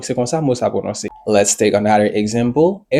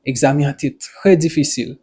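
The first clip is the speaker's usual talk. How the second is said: normal talking voice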